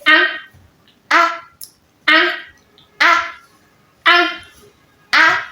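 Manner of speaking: "Ah" said high, short and clipped, lips spread wide; short bright ah, repeated; short ah sound. Shown as a demonstration. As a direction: model the short "ah" clearly. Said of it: "so now listen".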